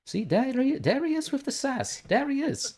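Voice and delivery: very high, playful teasing cry; teasing singsong. Pretend. Streamer teasing a sassy viewer, high singsong. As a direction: sing it out high, held up on "he". Mock-greeting the sassy chatter.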